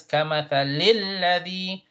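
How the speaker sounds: doubled L of "الذي" held and stressed; emphasized shaddah. Shown as a demonstration. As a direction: recite "كمثل الذي" leaning on the double L. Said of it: "so emphasize".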